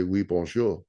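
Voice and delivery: a touch of French accent on "Oui, bonjour"; French phrase. Shown as a demonstration. Showing the little French he knows, light French accent. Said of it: "I can say".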